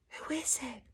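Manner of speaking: hushed, breathy question; whisper. Acted. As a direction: whisper it with suspense. Whispered aside to the children.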